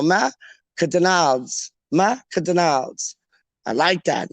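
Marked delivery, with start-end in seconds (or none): 0.0-3.1 slow Macadonalds, like a French restaurant; posh pronunciation. Shown as a demonstration. Host rehearses the restaurant name, posh Macadonalds. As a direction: draw out Ma-ca-donalds. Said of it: "sounds like a proper, like, French restaurant".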